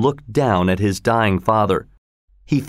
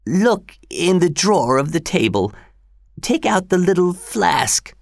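put-on old father voice; 8 semitones higher than usual. A narrator's reading. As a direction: voice the frail father, rising.

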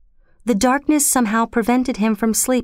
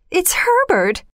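a high, frantic cry of hope; wife's high excited cry; 11 semitones higher than usual. Acted. Mother crying out at the door for her lost son, high. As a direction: cry out the name, high and desperate. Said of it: "she cried".

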